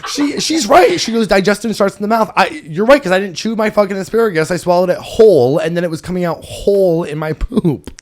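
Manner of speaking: high, loud, coming off a laughing fit; worked-up confession. Real, not acted. Host conceding dietitian friend was right, loud and high.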